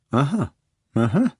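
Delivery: knowing doctor's "uh-huh"; very satisfied. A narrator's reading. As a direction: hum agreement as if a diagnosis is confirmed. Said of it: "very satisfied".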